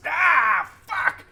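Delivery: loud, high-pitched yell of pain; pained shout. Acted. Game master voices Gray hit by an arrow, shouting. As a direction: cry out and curse as if just hit. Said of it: "He, he shouts".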